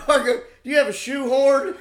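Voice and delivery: high, loud laughing plea; hammed-up drunk request; mock drunk plea. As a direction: whine like the drunk buddy. Jason asking, retold.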